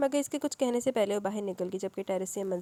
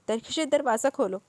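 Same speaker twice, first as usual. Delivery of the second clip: mother calling through the door, louder; a mild raised call; 4 semitones higher, 7 dB louder than usual. Acted. Mother calls her daughter at the door, raised. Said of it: "امی زور سے بولی".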